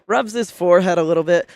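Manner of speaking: ordinary talk, nothing marked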